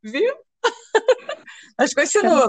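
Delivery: laughing. Real, not acted. Laughing tease.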